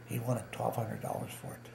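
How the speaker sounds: normal talking voice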